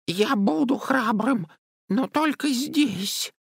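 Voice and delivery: very high, slow squeaky voice; timid little creature. A narrator's reading. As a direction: squeak timidly from a hiding place. Timid Chauncey speaks from hiding. (робкий тоненький голосок)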